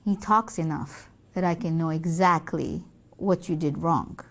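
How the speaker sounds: low, measured reproof; stern mother. Acted. Mother telling daughter she knows, stern. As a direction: answer softly and low, with firmness.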